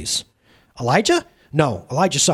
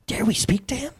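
hushed, breathy whisper; 6 semitones higher, 5 dB quieter than usual. Acted. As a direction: whisper it so he can't hear. Angels awed by the newcomer.

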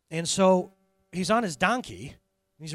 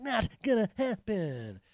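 slow, odd-voiced refusal; a donkey staring at an angel, unmoved; stubborn donkey; 4 semitones higher, slower than usual. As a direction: drag it out, unimpressed.

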